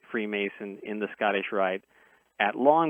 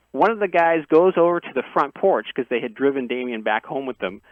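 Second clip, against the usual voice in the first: ordinary talk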